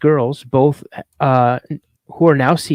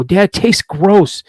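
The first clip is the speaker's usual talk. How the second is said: higher, slow mock complaint; grossed-out kid; 5 semitones higher, 4 dB louder, slower than usual. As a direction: imitate a child mildly disgusted. Retold child disgusted by coffee.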